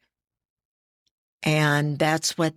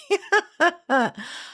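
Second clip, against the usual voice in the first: short high laugh at herself; 13 semitones higher than usual. Real, not acted. Laughs at own pronunciation trouble.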